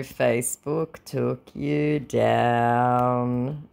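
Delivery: very slow, stretched words; long drawl on each word; drawn out. Acted. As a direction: say it word by word as if typing it. Drawls out reply while typing.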